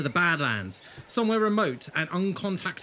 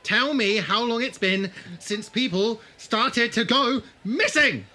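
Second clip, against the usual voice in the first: loud, slow, higher confrontation; angry demand; 8 semitones higher, 7 dB louder, slower than usual. Acted. Regan interrogating Carlos about disappearances, angry loud demand. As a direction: press him hard. Interrogating bar owner angrily.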